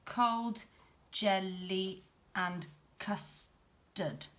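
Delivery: slow, every syllable separate and even; robot voice. Shown as a demonstration. Teacher showing pupils robot speech, very slow. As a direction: say cold, jelly and custard like a robot. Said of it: "speaking a little bit like a robot".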